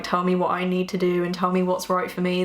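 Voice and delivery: usual speaking voice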